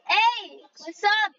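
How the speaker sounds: ordinary talk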